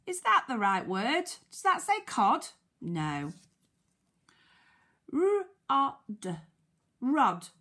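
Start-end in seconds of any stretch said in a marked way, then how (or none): 5.1-7.5 sounding out. Shown as a demonstration. Teacher checking the word with children, sounding out. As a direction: separate letter sounds, then the word.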